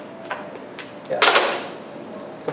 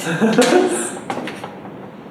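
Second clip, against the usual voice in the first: laughing; 6 semitones higher than usual. Real, not acted. Laughing at his own impression.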